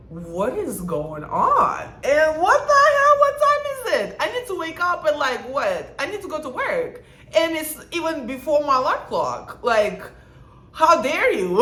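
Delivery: outraged wake-up. Retold or imitated. Woken by banging at 6 AM.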